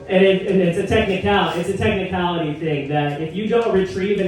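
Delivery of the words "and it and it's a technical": normal talking voice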